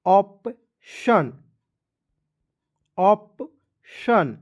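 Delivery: Option stretched out very slowly, syllable by syllable; option said extremely slowly for students to copy; very slow model. Shown as a demonstration. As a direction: draw out option as long as you can, like a teacher. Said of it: "P का प लिखना है".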